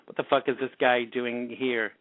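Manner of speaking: normal talking voice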